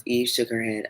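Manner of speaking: ordinary delivery, nothing marked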